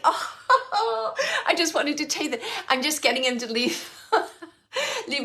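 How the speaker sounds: talking through real laughter; laughing. Real, not acted. Medium laughing while sending the spirit away. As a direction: giggle as you explain.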